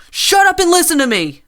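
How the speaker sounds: furious high scream. A narrator's reading. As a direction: scream it at the whole store. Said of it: "the woman screamed".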